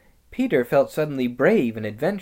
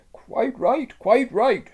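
high, eager beaver voice; lisping buck-toothed beaver; 11 semitones higher, 5 dB louder, slower than usual. Acted. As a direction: agree quickly and keenly. Beaver agrees.

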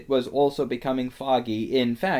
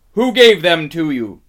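loud, raised, imperious demand; sharp interrogation; 6 semitones higher, 13 dB louder than usual. A narrator's reading. Witch questions the frightened fox, sharp interrogation. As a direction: demand the culprit's name.

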